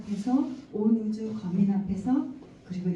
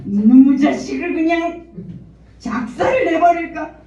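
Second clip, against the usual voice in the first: loud, higher, furious threat; furious mother; 8 semitones higher, 12 dB louder than usual. Retold or imitated. Mother enraged at her daughter's bully, loud and furious. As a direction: blow up at the bully in rage. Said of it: "이게 제 옛 본성이에요".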